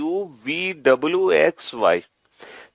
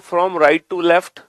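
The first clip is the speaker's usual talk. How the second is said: slow emphasis; much slower than usual. Shown as a demonstration. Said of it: "अब आप reverse भी count करो".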